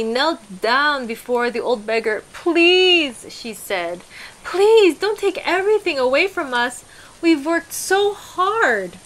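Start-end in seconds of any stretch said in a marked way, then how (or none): 2.3-3.3 high, loud desperate plea; Anna pleading. A narrator's reading. Kneeling wife begs the old hermit, high and loud. 4.4-8.9 high, loud desperate plea; Anna pleading. A narrator's reading. Kneeling wife begs the old hermit, high and loud.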